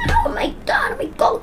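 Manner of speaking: high squeal, then breathless; panicked surprise. Retold or imitated. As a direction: gasp it out as the guards appear. Suddenly swarmed by guards.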